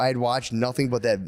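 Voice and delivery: ordinary delivery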